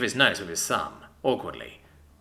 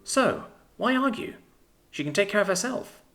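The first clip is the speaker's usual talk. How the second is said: higher, lightly voiced woman, reasoning; narrator's higher voice for Alison; girlfriend voice; 6 semitones higher than usual. A narrator's reading. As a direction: talk him round, lightly incredulous.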